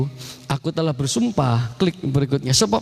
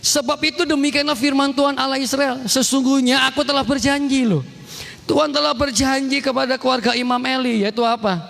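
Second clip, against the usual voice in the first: high-pitched, impassioned preaching; scripture proclamation; 10 semitones higher than usual. Real, not acted. Preacher reads God's promise to congregation, high declamation.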